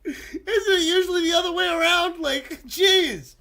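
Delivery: high laughing voice, near tears; gasping the question through giggles. Real, not acted. To the friends teaching him, laughing helplessly.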